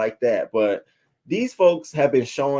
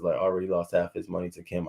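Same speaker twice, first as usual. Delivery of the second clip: ordinary delivery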